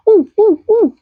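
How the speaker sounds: excited high ooh. Acted. Puppet excited.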